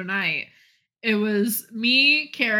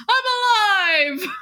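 much higher, slow, loud cry; triumphant shout; 10 semitones higher, 5 dB louder, slower than usual. Retold or imitated. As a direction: burst back to life, hammed up. Sam revives triumphantly.

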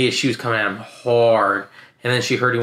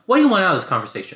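fast, higher-pitched exasperated question; mock exasperation; 6 semitones higher, much faster than usual. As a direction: rattle it off like you're fed up, half laughing. Retells his exasperated question.

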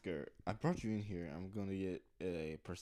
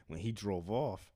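ordinary talk

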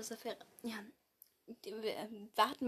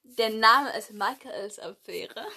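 ordinary talk

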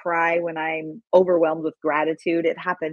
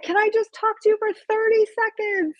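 retold shout; faster than usual. Fan calling to the rapper across backstage, high pleading call. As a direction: call after him, begging for a moment. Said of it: "literally shouting this".

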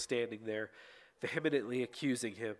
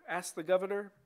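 usual speaking voice

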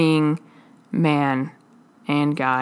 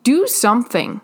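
higher, urgent plea; pleading with God; 6 semitones higher than usual. Retold or imitated. Preacher voicing his own prayer to God, higher urgent pleading. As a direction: beg God to take it away. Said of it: "As I plead to God".

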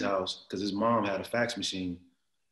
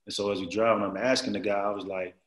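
normal talking voice

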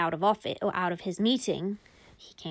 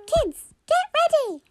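very high, louder squeak; squeaky calling out; 7 dB louder than usual. Acted. Mummy speaks into the toy to the kids, squeaky. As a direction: call out in a tiny squeak. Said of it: "She spoke into the toy".

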